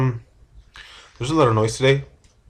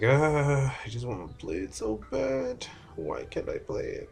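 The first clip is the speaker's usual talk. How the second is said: whiny lament; 3 semitones higher, 6 dB quieter, slower than usual. Retold or imitated. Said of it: "I was like".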